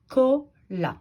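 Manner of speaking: "cola" in two slow, separate beats; syllable by syllable. Shown as a demonstration. Teacher showing what remains, "cola" split slowly. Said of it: "j'ai retiré la syllabe".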